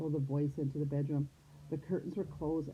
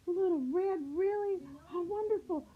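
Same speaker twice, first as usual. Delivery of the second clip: very high-pitched excited grandmother voice; high delighted old-lady role; delighted grandma; 13 semitones higher than usual. A narrator's reading. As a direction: greet a grandchild in a high, thrilled voice. Grandma greets supposed granddaughter.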